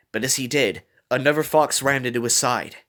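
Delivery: usual speaking voice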